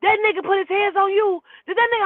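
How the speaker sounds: loud, high, urgent demand; alarmed questioning. Retold or imitated. Driver acted out, alarmed for her.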